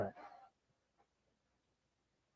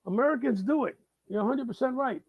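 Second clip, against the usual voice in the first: much higher, sing-song fake concession; sarcastic agreement; 7 semitones higher than usual. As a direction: pretend to agree, dripping sarcasm.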